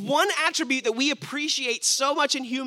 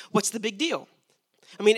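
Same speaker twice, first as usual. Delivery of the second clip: ordinary delivery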